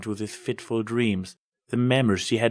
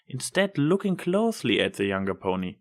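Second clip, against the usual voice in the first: ordinary delivery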